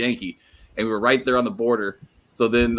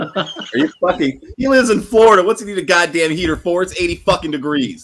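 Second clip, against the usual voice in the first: high, loud outraged disbelief; he lives in Florida, outraged and laughing; incredulous outburst; 6 semitones higher, 5 dB louder than usual. Real, not acted. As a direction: blow up in disbelief at the heater. Outrage at heater request.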